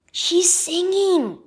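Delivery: ordinary delivery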